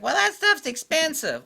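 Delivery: high, loud mock complaint; mocking complainer. Mimics complaining cheap buyers.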